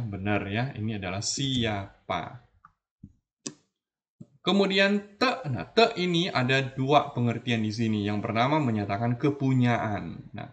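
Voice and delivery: ordinary delivery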